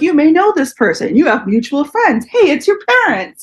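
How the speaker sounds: high, chirpy mock of the Facebook prompt. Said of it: "they're like".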